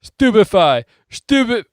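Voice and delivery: high, loud, yelled spell; shouting spell. Acted. Neville shouting spells at each Death Eater. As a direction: fire off the spell at full yell. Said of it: "Neville shouted again".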